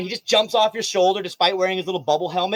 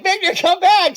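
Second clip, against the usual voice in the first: high, loud call after a runaway pet; 14 semitones higher, 7 dB louder, slower than usual. Retold or imitated. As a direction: yell after the mouse. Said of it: "you're like".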